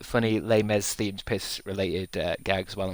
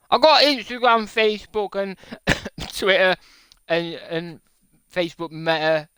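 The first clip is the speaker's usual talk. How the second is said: excited listing; 9 semitones higher, 5 dB louder than usual. Acted. Boastful listing of apps.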